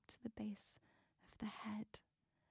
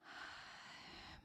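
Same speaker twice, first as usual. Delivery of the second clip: slow sigh; 14 dB quieter than usual. Acted. Meditation, long exhale.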